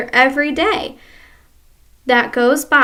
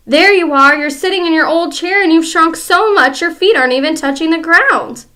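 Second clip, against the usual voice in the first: louder gloating shout; 5 semitones higher, 7 dB louder than usual. Acted. Husband shouting at his wife in triumph. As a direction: yell it at her in triumph.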